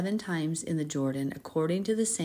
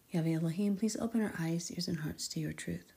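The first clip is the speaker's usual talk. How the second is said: soft, reverent prayer to God; hushed prayer; 8 dB quieter than usual. Real, not acted. Host praying softly before the reading. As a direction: pray quietly, fading toward the end.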